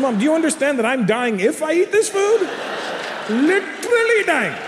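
put-on a six-year-old arguing back in desperation; a high, put-on child's voice crying out in protest; whiny kid. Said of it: "I said".

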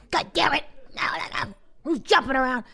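high-pitched mock scolding shout; exasperated yell at noisy kids. Wife mimicking her husband yelling at the kids, mock furious. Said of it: "you start yelling at the kids".

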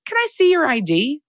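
high-pitched mock demand; put-on high, prim request; officious Karen. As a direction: pitch it way up like a meddling busybody.

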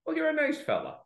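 lightly voiced pub friend being patronising; mock condescending. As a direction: pat him on the head with it. Voicing a condescending answer.

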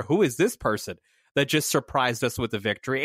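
normal talking voice

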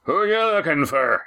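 innkeeper calling through a door, high pitch. A narrator's reading. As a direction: call out to strangers outside. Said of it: "ended with Walt's voice".

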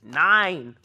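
loud, high barked German "Nine!"; hammed-up German accent shouting "Nine!"; mock German. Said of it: "The Germans are like".

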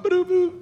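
high, quick electronic boop; imitated card-reader beep. Girl swipes the gift card, imitated beep. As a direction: mimic a machine's high two-note beep.

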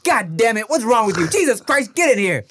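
high, loud mock yell of an angry father; scolding a kid, loud and exasperated; angry dad yell. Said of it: "my dad would be like".

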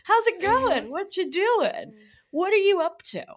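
put-on very high sing-song baby talk; playful cooing. Shown as a demonstration. Talking lovingly to her own anxiety, high cooing. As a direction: coo at it like a cute pet. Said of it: "little shimmy shake".

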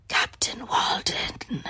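slow, rasping whispered gravel; gravelly voice. Retold or imitated. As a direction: rasp it out slowly like a haunted castaway. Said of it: "in, like, this gravelly voice".

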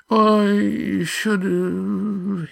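high, drawn-out moan; stammering moan. A narrator's reading. Yan hides his relief in guilt, moaning. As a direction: moan it like staged remorse. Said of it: "he stammered".

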